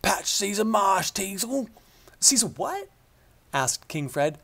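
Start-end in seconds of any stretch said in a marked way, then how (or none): 0.0-1.7 put-on old countryman spinning his tale; rustic old shepherd. A narrator's reading. Shepherd telling the king his tale, rustic. 2.2-2.9 high, baffled question; puzzled king. A narrator's reading. King puzzled by the shepherd's word, baffled.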